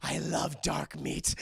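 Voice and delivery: slow, menacing creature voice; hammed-up slow monster line; monstrous hunger. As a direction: draw it out like a hungry monster. Monster girl about to attack.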